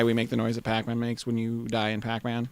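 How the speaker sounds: usual speaking voice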